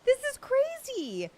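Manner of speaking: high-pitched, laughing disbelief; overwhelmed thanks. Real, not acted. Streamer stunned by viewers' gifts, high overwhelmed squeak. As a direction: squeak it out, overcome with gratitude.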